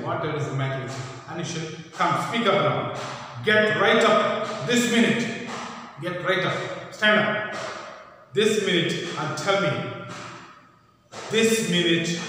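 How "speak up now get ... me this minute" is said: stern command. Acted. Marilla ordering Anne to get up, loud and stern. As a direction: order her up this minute. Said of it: "Marilla got very angry".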